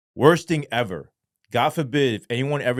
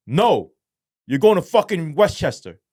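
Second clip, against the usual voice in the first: guard voice; 7 semitones higher, 8 dB louder than usual. Retold or imitated. Guard answers him.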